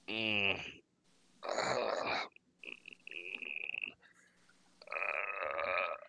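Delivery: soft groaning in a bad dream; sleeper's groan. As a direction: groan quietly, as if stirring in sleep. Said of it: "kinda, kinda groaning".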